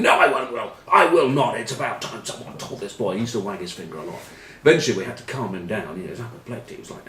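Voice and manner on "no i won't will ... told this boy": lost it. Retold or imitated. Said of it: "he lost it".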